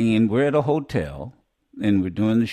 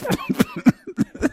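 real laugh. Real, not acted. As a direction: burst out laughing at the joke. Laughing at own joke.

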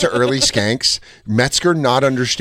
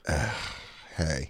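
reluctant groan; 8 semitones lower, 16 dB quieter than usual. Retold or imitated. Reluctant greeting.

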